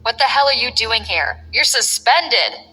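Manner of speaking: ordinary delivery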